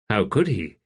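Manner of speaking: usual speaking voice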